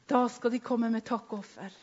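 usual speaking voice

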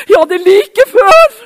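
hammed-up shout and laugh; loud comic reply. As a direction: blurt it out with a laugh. Retold comic exchange at hospital.

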